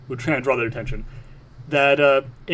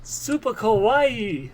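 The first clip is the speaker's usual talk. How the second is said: high, slow kawaii coo; cutesy anime girl; 8 semitones higher, much slower than usual. Pretend.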